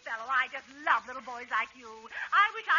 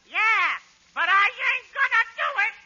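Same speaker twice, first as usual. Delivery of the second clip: put-on bratty kid voice; 8 semitones higher, 9 dB louder than usual. Costello plays bratty Sebastian.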